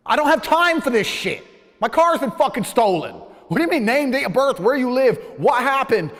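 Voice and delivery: shouting high and loud at the police; exasperated shout at policewomen over a stolen car; furious yell. Retold or imitated. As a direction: blow up at the officers. Retold outburst at police.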